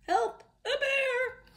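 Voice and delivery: very high, drawn-out mock scream for help; campers' scream. Campers spotting a grizzly, high theatrical scream. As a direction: scream it high, stretching each word. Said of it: "makes the campus scream".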